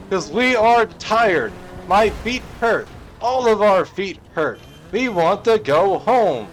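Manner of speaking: high, loud, slow shouted ultimatum; defiant scream. Retold or imitated. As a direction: bellow the terms out from the sea. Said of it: "from screaming from the water".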